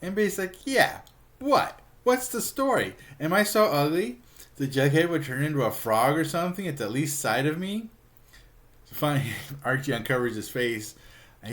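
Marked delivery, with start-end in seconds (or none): 0.6-7.9 very high mock indignation; offended demand. Betty asks Archie if she's so ugly, indignant. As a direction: imitate an affronted girl, pitch soaring.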